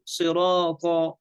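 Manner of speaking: "صراطا" with heavy s, r and t; careful sirata, thick back "aa" in "صراطا"; three heavy letters. Shown as a demonstration. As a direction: make all consonants of "صراطا" full and emphatic. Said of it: "الثلاثة مفخم".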